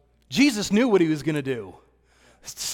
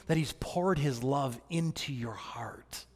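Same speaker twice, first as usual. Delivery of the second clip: slow intimate whisper; hushed, slow and close; 3 semitones lower, 8 dB quieter than usual. Real, not acted. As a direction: draw them in with a whisper.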